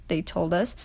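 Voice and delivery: ordinary delivery, nothing marked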